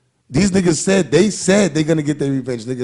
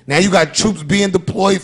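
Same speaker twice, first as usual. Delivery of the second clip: ordinary delivery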